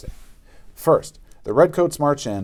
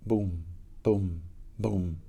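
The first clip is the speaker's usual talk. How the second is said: heartbeat; 4 semitones lower, 10 dB quieter, much slower than usual. A narrator's reading. Heartbeat in tense silence.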